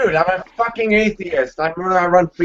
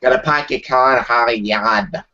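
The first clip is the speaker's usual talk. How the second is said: put-on r-dropping Boston accent, low; 5 semitones lower than usual. Panelist showing off how Boston talks, in a Boston accent. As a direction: lay on a thick Boston accent. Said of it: "thirteen's from Boston".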